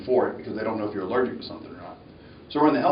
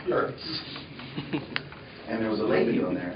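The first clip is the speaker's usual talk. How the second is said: ordinary delivery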